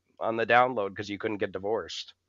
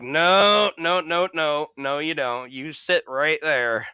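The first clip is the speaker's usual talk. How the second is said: loud firm no, easing down; firm insistent no; 6 semitones higher, 4 dB louder, slower than usual. Retold or imitated. To the injured man, insisting he stay seated, firm and loud. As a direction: insist he stays put, loud then settling.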